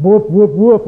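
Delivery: slow, loud woof woof woof; mock dog bark. Preacher mocking a cat barking like a dog.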